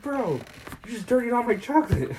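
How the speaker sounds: high, loud mock outrage; outraged Wonka. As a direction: squeal it like your candy got ruined. Wonka scolding the kid.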